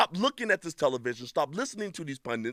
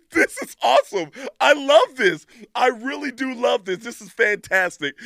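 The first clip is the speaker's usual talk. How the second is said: excited; 7 semitones higher, 6 dB louder than usual. Real, not acted. Delighted by meme.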